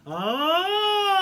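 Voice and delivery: high loud ringing ah; high ah call. Shown as a demonstration. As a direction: launch a big high ah. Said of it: "Let's do one more of those".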